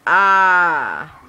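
loud frustrated groan; exasperated groan. Real, not acted. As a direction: let out a loud fed-up groan. Frustrated outcry.

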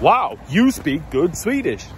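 mock the locals' patronizing praise; condescending praise. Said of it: "it's a bit condescending".

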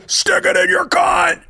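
high, slow mock rock-singer shout; imitating the frontman's yell. Retelling the embarrassing gig moment, high hammed-up rock shout. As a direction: bellow it like a frontman egging on a silent crowd. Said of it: "And he goes".

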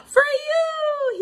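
put-on high, excited child voice; beaming. Little one proudly giving his mother toys, beaming. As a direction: offer the gift with delight. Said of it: "he beamed".

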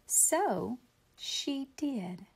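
drawn out slowly and softly; slow, soft payoff for the kids. A narrator's reading. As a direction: let the payoff land slowly. Slow payoff line to children.

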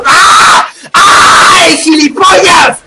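high, drawn-out yell cutting off the chat; sudden exasperated outburst at the game; startled game rage scream. Real, not acted. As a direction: scream the insult out long and high. Sudden in-game mishap mid-chat.

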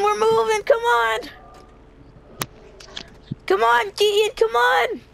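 usual speaking voice

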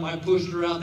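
ordinary talk, nothing marked

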